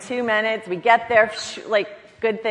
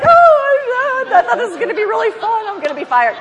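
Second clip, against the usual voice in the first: laughing dismay; 14 dB louder than usual. Retold or imitated. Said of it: "And I was like".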